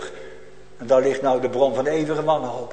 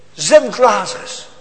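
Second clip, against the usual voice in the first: loud, slow, higher demand; demanding plea; 6 semitones higher, 8 dB louder, slower than usual. Acted. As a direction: bark the order from the depths. Rich man's demand from hell. (luide, trage eis)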